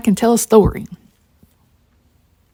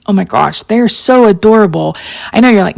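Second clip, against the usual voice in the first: usual speaking voice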